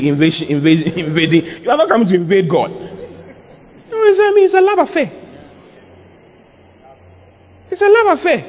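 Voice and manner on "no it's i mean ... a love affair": very high-pitched cry; emphatic exclamation. Real, not acted. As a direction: squeal it out in a high register. Exclaiming the point to congregation.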